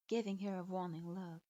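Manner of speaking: usual speaking voice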